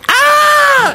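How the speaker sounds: very high shrieked ah; retold class scream. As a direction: shriek like startled kids. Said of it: "everybody goes".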